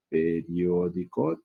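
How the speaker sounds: "Periódico" stretched out slowly; teacher's measured breakdown of "Periódico"; syllable by syllable. Shown as a demonstration. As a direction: sound out "Periódico" slowly for a class. Teacher sounds out example word.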